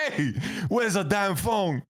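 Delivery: high, slow mock-tough demand; mock confrontation. To the thief in the chair, mock tough demand. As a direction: lean in on the thief.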